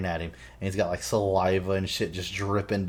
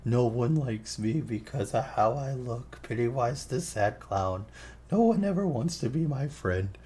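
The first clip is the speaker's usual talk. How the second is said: mock-tearful, slow, high clown whine; Pennywise; 4 semitones higher than usual. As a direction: play the sad clown, drawn out and higher. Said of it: "acting like he's crying".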